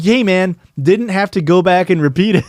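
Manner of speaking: loud, high, incredulous objection; exasperated protest. Retold or imitated. Reacting to his boss repeating the slur, loud exasperated protest.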